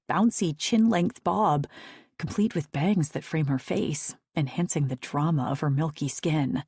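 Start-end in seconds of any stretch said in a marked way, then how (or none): none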